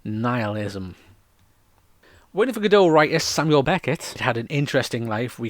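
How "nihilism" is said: deadpan, drained of emotion; low-key, unexcited delivery; emotionless. Shown as a demonstration. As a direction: say it with no feeling either way. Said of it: "not meant to have any emotion to it".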